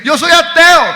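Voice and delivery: loud, high-pitched boast; boastful atheist. Retold or imitated. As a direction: crow it proudly for all to hear.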